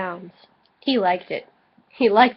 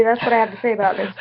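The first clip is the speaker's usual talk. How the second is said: rushed aside; much faster than usual. Pretend.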